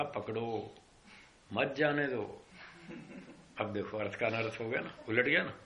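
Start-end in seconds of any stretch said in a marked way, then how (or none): 0.0-2.5 "पकड़ो", a pause, then "मत, जाने दो" as one phrase; pause after पकड़ो. Retold or imitated. Jailer correcting the constables, pausing after "पकड़ो" before "मत, जाने दो". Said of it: "मैंने ये थोड़ी कहा था".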